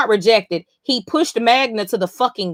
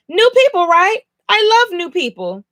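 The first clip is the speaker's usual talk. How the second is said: much higher, bright and bouncy delivery; a giddy girl meeting strangers, pitched way up; high perky Princess; 9 semitones higher, 5 dB louder than usual. Retold or imitated. Princess greeting the newcomers, high giddy voice. Reading Princess's bubbly lines.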